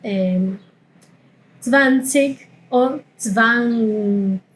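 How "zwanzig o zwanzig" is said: slow zwanzig first with K then with ch; two ways of zwanzig. Shown as a demonstration. Teacher contrasting both zwanzig endings. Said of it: "Ambos están bien".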